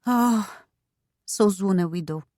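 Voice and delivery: put-on sighed French-accented sympathy; mournful, accented sighing; heavy sigh. A narrator's reading. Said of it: "She sighed heavily".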